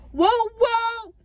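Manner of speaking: high, loud celebratory whoop; excited whooping. Real, not acted. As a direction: whoop it out for the hometown. Cheering arrival in home county.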